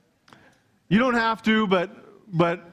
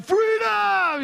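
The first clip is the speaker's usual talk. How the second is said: Braveheart freedom yell. Retold or imitated. Pastor imitates Braveheart for his church, high freedom shout. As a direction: bellow it like a warrior charging into battle. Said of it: "Like Braveheart".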